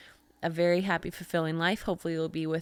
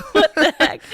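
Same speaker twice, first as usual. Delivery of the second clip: laughing; 12 semitones higher, 12 dB louder than usual. Real, not acted. Laughing at his panic.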